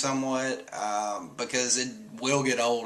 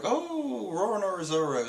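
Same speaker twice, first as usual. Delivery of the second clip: mock scared; 8 semitones higher than usual. Caribou recognizing Zoro, high mock fright. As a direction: stretch it high as fear dawns. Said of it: "So he's kinda scared".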